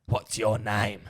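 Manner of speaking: lightly voiced old woman asking, slow; a hint of a husky old lady. Retelling the customer asking Brad his name, lightly voiced old woman. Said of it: "That's how she sounded?".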